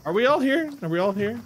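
a peculiar singsong check-in; odd questioning voice. Real, not acted. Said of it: "Why are you saying it like that?".

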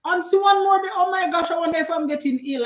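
high, surprised "oh my gosh"; surprised high exclaim. Real, not acted. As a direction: squeal in mock alarm. Surprised by viewer comment.

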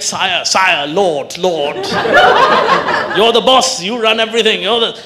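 grovelling flattery, bowing low; butter up the master; hammed-up servile praise; mock fawning.

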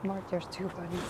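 barely voiced, careful not to startle; hushed. Real, not acted. Woman repeating the rabbit news to boyfriend, hushed. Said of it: "I don't wanna scare them away".

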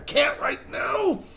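high, drawn-out exasperated cry; frustrated wail. Real, not acted. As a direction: wail it slowly, bursting to tell. Teasing news he cannot share.

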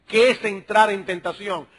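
ordinary talk

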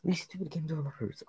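loud, high outburst of frustration; real gamer rage. Real, not acted. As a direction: rage at the game, pitch shooting up. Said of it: "I'm raging".

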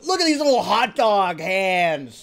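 loud, insistent outburst; worked-up, emphatic cry; exasperated exclamation. Real, not acted. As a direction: insist they look, raising your voice. Shows off hands in mock outrage.

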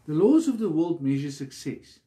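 usual speaking voice

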